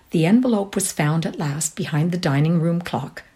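plain reading voice

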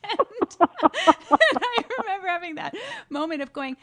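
talking through real laughter, high and loud; laughing. Real, not acted. Guest laughing at her own practicum story, words breaking into laughter.

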